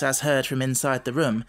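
ordinary delivery, nothing marked

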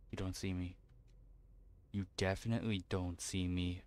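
soft, low hushed pleading; whispering while hiding. Real, not acted. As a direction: breathe it so he won't hear. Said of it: "whispering while hiding".

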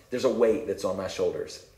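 ordinary delivery

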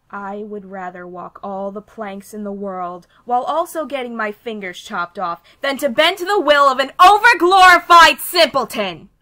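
starts steady, ends in a loud yell; defiant rant, building. Acted. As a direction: build the refusal into a furious shout.

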